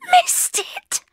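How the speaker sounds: very high, loud squeal of horror; panicked disbelief. Acted. Bridget repeating the bad news, panicked disbelief. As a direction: shriek the question in panic.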